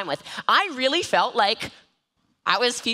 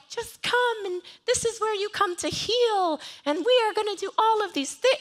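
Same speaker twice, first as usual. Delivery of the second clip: whiny; 12 semitones higher than usual. Retold or imitated. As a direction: coo it sweetly, much higher. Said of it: "I was a little bit whiny".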